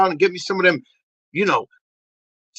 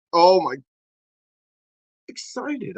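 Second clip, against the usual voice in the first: ordinary delivery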